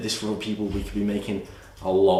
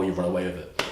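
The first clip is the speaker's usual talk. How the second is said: normal talking voice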